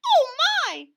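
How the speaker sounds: very high, loud squawk; cartoon bird squawk. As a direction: squawk in fright. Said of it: "squawked Dovey Lovekie".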